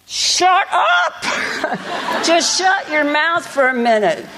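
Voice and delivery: high-pitched comic yell, easing slightly; mock fed-up shout with a laugh; acted exasperated outburst. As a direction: blurt it out high, hammed-up exasperation. Acting out a stressed woman's outburst.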